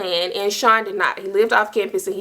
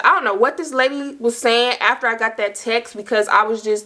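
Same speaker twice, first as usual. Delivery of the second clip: ordinary talk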